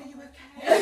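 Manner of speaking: breathy laughing whisper. Retold or imitated. Speaker to her crying sister during the divorce, whispering and laughing. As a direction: whisper it while giggling.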